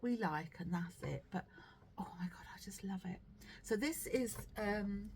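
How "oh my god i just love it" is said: 1 whisper. Real, not acted. Whispered delight over the sweater.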